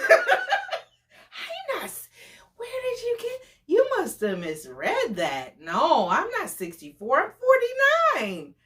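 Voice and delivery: high, sputtering nervous laugh and denial; flustered denial. Retold or imitated. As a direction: scramble to cover the lie. Date caught lying, flustered.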